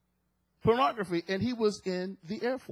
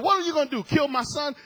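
higher, louder taunting challenge; 5 semitones higher, 4 dB louder, faster than usual. Acted. Man challenging God about his son, taunting. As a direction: throw the dare upward forcefully. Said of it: "he began to taunt the Lord".

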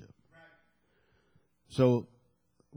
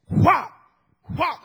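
loud punchy whop, pitched up; whop sound effect; 10 semitones higher, 7 dB louder than usual. Retold or imitated. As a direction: smack it out like a punch landing. Sound of punches in dream fight.

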